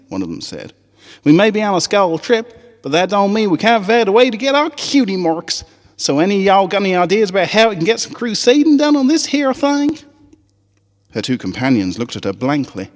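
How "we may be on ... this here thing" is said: much higher, Southern twang, eager; high country filly voice. Acted. Apple Bloom rallying the Crusaders on the school trip, high Southern twang.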